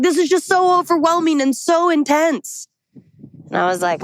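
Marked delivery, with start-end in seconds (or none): 0.0-2.7 imitating an overwhelmed friend, high-pitched; high, worked-up mock complaint; upset. Friend overwhelmed by her questions, upset. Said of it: "why you are getting so upset".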